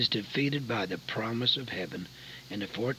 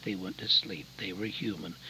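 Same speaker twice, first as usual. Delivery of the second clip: hushed; 4 semitones lower, 7 dB quieter than usual. Acted. Hushed close of sermon story.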